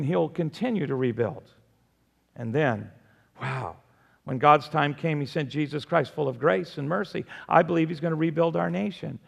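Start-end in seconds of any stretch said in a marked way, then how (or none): none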